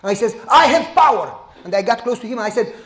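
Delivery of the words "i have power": louder, higher domineering elder; imitating a pushy elder, louder; bossy boast. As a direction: throw the boast right in his face. Elder repeats boast, stepping closer.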